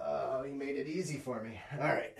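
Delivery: usual speaking voice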